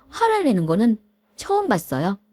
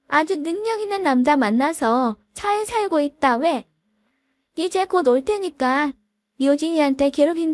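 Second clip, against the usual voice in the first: higher voice, sarcastic needling; smug female sniping; 7 semitones higher than usual. Acted. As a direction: needle her with a smug sneer. Dishevelled classmate needles the couple. (동창생 여자의 비꼬는 목소리)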